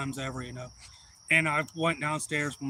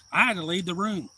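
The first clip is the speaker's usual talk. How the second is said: ordinary talk, nothing marked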